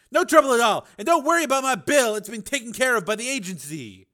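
high, loud doctor voice; bright-pitched, forceful courtesy; obliging doctor. A narrator's reading. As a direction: wave away the thanks emphatically. Doctor figure declines thanks.

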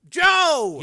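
high, loud shout of a name; warning yell. A narrator's reading. Frank distracting his attacker, warning yell. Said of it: "he yelled".

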